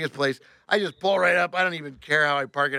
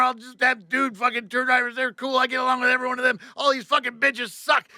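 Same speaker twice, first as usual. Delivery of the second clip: high, ranting tirade that gets louder; re-enacted furious venting; screaming rant; 10 semitones higher, 4 dB louder than usual. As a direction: rage on without letup, voice pitched way up. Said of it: "I'm screaming. I'm going".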